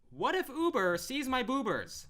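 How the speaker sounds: high, loud, drawn-out hammed-up quote; mock exclaiming. Recapping DJ's worry in a dress, hammed-up loud cry. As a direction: belt it out slowly in mock alarm. Quoting a character's silly line.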